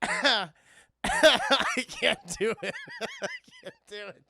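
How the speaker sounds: laughing through the words; can barely speak for laughing; helpless laughter. Real, not acted. Streamer breaks down laughing mid-reading, real laughter. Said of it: "It's too much".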